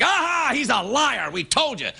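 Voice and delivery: slow, triumphant gloating shout. Retold or imitated. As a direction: crow it like you caught him. Fox News voiced gloating.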